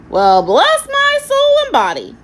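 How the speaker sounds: very high, loud parrot squawk. A narrator's reading. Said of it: "he remarked".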